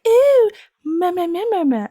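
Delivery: high, loud ooh into nonsense mehs; mocking boyfriend. Boyfriend mocking her voice acting, teasing. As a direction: make fun of her with a silly high babble. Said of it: "he is making fun of me".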